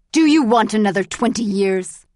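loud, threatening guard's bark; angry yell. A narrator's reading. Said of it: "yelled Ivanov".

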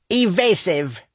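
high, sharp order; snapped. A narrator's reading. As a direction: snap the order at the crew. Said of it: "Indrat snapped".

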